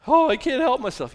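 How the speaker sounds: high put-on wail of helplessness; mock helplessness. Preacher mimics someone giving in to temptation, mock helplessness. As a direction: plead it high, as a hammed-up excuse. Mocking excuse, then answered low.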